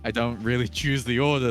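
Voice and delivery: ordinary delivery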